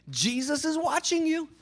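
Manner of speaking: high-pitched parrot warning. Acted. Hidden parrot warning a burglar, high-pitched. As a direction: pipe it up high like a talking bird. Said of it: "there's this parrot sitting there".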